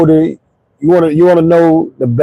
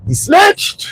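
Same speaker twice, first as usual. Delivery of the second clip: sudden high yelp of outrage; punchline yell; 13 semitones higher than usual. Acted. Punchline about snitching.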